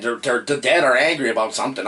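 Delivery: ordinary talk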